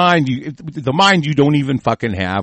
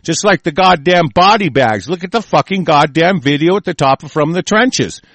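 ordinary delivery

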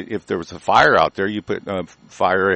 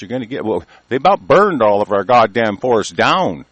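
ordinary talk

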